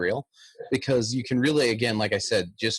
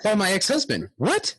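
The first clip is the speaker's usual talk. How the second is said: excited judge; 9 semitones higher than usual. Retold or imitated. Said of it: "She goes".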